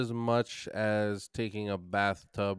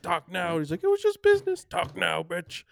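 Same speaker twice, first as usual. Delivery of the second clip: high, mocking taunt; taunting fighter; 8 semitones higher, 5 dB louder than usual. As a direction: sneer the taunt. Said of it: "he was talking shit".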